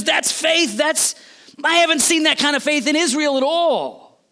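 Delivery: high, loud, amazed delivery; marveling. Retold or imitated. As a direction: exclaim it in acted astonishment. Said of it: "He's marveling".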